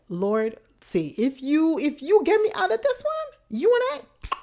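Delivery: loud plea climbing very high; desperate plea. Retold or imitated. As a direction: bargain with God, hammed-up and rising. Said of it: "so desperate".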